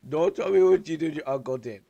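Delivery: laughing scold. Acted.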